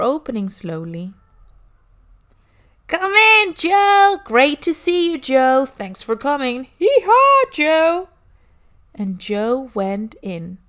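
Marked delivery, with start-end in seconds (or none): 2.9-6.6 much higher and louder, a booming party greeting; loud excited welcome. Acted. Party guests greeting Joe at the door, loud and excited. 6.8-8.1 a high, loud hee-haw of a donkey; donkey bray. Acted. A donkey party guest braying hello to Joe, loud and high.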